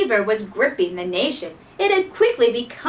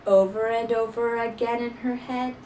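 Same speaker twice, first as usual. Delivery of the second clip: usual speaking voice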